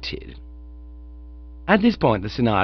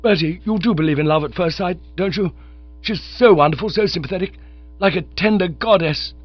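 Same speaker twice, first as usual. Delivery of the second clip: higher, rushing rapture; lovestruck young chap; 6 semitones higher than usual. Acted. Bingo gushes to Bertie about love, eager. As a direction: gush about the girl.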